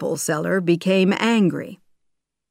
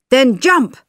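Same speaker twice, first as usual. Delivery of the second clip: urgent shout; 7 semitones higher, 8 dB louder than usual. A narrator's reading. Urging a leap from danger.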